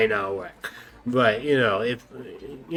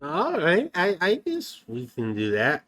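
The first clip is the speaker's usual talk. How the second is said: normal talking voice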